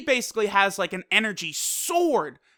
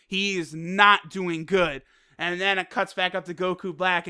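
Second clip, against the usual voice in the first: ordinary talk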